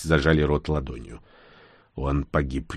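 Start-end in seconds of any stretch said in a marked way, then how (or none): 1.9-2.8 low, softened admission; subdued, grudging statement; reluctant. A narrator's reading. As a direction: give the bad news unwillingly. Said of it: "нехотя сказал Бур".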